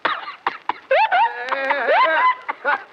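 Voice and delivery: very high comic yelps; shrieking bursts, high above normal voice; high whooping reaction. Acted. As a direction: whoop wildly after a strong drink. Reacting to the strong wine.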